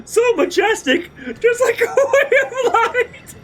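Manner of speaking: high, squealing laugh-talk; real hysterics, begging it to stop; laughing to tears. Real, not acted. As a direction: gasp out praise through helpless laughter. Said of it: "Oh, I'm in tears".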